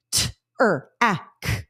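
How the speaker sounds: "T, er, ak" said as separate sounds; segmenting phonemes; segmented sounds. Shown as a demonstration. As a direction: break track into pieces "T, er, ak". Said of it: "Four individual sounds".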